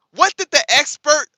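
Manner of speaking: ordinary talk, nothing marked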